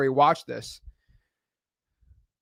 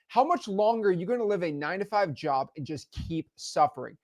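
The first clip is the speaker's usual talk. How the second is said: ordinary delivery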